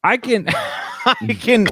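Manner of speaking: normal talking voice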